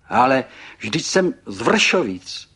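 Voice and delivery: loud, high, drawn-out protest; exclaiming. A narrator's reading. Pan Burgán explains himself to the guest, exclaiming. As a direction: cry it out slowly and emphatically. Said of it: "zvolal a podrbal se".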